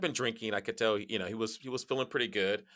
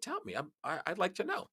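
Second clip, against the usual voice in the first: curious; 10 semitones higher than usual. Retold or imitated. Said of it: "I said".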